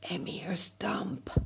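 breathy airy whisper; whispering wind. Acted. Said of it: "the wind said".

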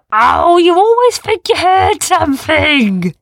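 slow, loud, high scoffing; scoffing beasts. A narrator's reading. The beasts scoff at their nervous friend, loud. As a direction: roll the eyes at the worrier. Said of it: "said the others".

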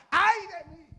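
crying out in woe. Acted. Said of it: "nos tiramos a llorar".

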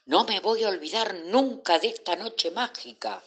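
high, elated exclamation without much volume; happy cry. A narrator's reading. Babol delighted after the night ride, happy cry. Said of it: "Babol gritaba feliz".

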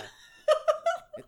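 high shrieking laugh; loud burst of laughter. Real, not acted. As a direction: crack up mid-sentence. Bursts out laughing mid-bit.

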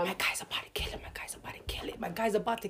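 excited whisper. Retold or imitated. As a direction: hush it like a secret thrill. Excited manager whispering to himself.

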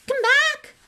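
high, loud yell after the car. Acted. Ron yelling after the departing car. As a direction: call it back. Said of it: "Ron yelled after it".

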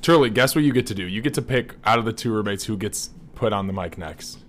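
usual speaking voice

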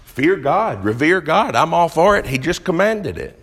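impassioned pulpit exhortation; fiery preaching. Acted. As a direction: drive it home to the congregation. Preaching at full force.

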